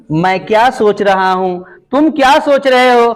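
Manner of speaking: usual speaking voice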